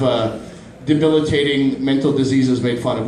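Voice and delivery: ordinary talk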